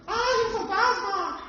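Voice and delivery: shrill high mock scream of a frightened girl; preacher mimics terrified disciples as teen girls. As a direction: squeal like a scared teenage girl. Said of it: "qué gritaban?".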